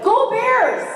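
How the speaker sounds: high, loud "Go Bears!" cheer. Retold or imitated. A director cheering his football team as she leaves. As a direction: cheer the home team loudly. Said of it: "and he went".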